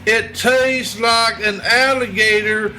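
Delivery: folksy Southern barroom salesman voice. Acted. Plays redneck Bubba selling alligator.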